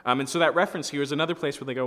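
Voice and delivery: ordinary talk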